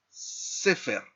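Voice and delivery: "sefer" with the stress on SE; stress on first syllable. Shown as a demonstration. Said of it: "se acentúa en la primera sílaba". ("sefer" con acento en la primera sílaba, SE)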